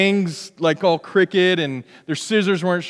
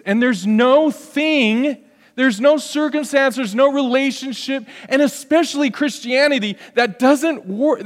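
preaching at full force; 6 semitones higher than usual. Real, not acted. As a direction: drive the point home from the pulpit. Preaching climax on no.

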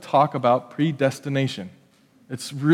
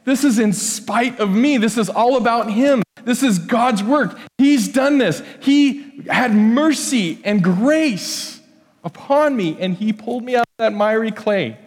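grateful exclamation; 6 semitones higher than usual. Retold or imitated. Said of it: "we have to go".

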